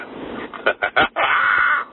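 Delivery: high breathless cackle; maniacal laugh. Real, not acted. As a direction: laugh at your own craziness. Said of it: "a maniacal, just realization".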